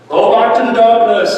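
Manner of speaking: lightly voiced Gandalf banishing evil. Preacher quotes Gandalf banishing the enemy, commanding. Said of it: "like Gandalf".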